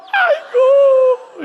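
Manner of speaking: a sarcastic mock wail at the gloating women. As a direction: wail it like a hammed-up lament.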